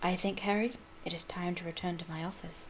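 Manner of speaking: soft, gentle murmur; soft calm old wizard; quiet voice. Acted. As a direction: speak softly into his ear. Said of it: "said a quiet voice".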